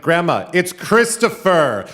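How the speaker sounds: high, loud, slow and stretched; shouting to be heard. Retold or imitated. Teenage grandson to deaf grandma on phone, slow shout. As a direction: spell it out loudly for deaf ears.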